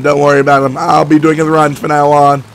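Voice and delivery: ordinary talk, nothing marked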